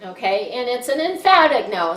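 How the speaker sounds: usual speaking voice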